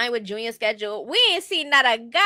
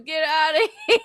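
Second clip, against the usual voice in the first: laughing through a quote; 4 semitones higher, 6 dB louder than usual. Retold or imitated. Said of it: "I was laughing".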